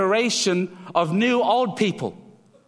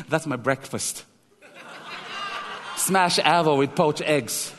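amused grandpa; 3 semitones lower than usual. Acted. Future grandpa answers, laughing.

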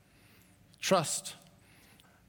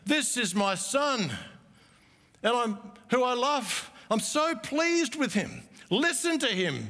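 higher and slower, thrilled and proud; excited Father's voice; 6 semitones higher than usual. Retold or imitated. Preacher voicing the Father at the transfiguration, excitedly proud. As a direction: announce your son like you can hardly contain yourself. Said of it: "sense the excitement in the Father's voice".

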